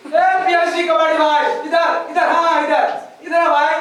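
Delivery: loud calling across the street, laughing. Acted. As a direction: hail him over loudly. Calling out to the dealer.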